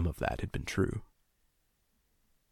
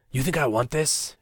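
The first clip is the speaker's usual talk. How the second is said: higher pitched, pleading and desperate; desperate, near tears; 7 semitones higher, 4 dB louder than usual. Acted. Captor pleads with his captive, desperate near tears. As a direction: crack with desperation, close to crying. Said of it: "He sounded desperate, near tears".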